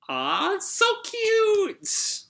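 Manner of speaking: a very high, slow squeal of delight; gushing aww. Real, not acted. Fan squeals over the cute naming flashback, gushing. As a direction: melt over something adorable.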